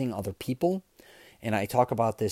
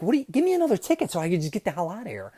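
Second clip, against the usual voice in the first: higher, fast, fed-up rush of words; mock exasperation, pitched up and hurried; exasperated retort; 7 semitones higher, faster than usual. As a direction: blurt it out like you're done with them. Retold exasperated outburst.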